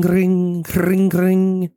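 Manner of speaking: high sing-song ring, ring imitating a phone; mock phone ring. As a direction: chirp ring, ring up high like a toy bell. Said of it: "a thing that goes ring".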